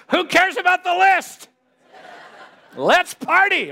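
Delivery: laughing, high and loud let's party; mock party cheer. Pastor jokingly cheering the congregation to party, laughing. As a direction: whoop it up as a joke.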